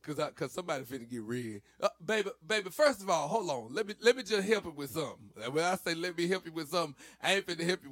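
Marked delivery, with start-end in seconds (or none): 1.8-7.9 higher, louder sassy talking-to. Pretend. To an imagined person, a higher sassy talking-to. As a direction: talk down to them with attitude.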